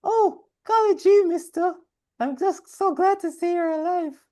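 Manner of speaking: put-on high, bright golly-gee kid voice; wholesome 1950s kid. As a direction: chirp it like a sweet little girl. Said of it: "she notices and is like".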